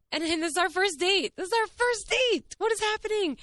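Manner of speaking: high-pitched excited squeal; giddy disbelief. Real, not acted. Vlogger gushing to viewers about first-date gifts, giddy disbelief.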